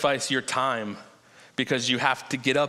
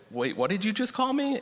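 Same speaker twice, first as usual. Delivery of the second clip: higher, incredulous question; a mother's playful disbelief, pitched up; mock surprise; 7 semitones higher than usual. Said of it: "And then she said".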